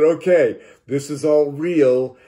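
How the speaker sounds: loud, high and drawn out; retold thrill of being convinced; excited realization. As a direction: declare it slowly and emphatically, amazed. Retold realization, just after laughing.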